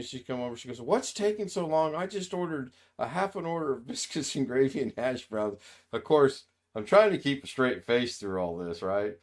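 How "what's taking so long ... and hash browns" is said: put-on upset old mom. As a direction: ham up a cranky mother griping at the waitress. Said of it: "Mom was a little upset".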